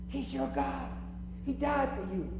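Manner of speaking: ordinary talk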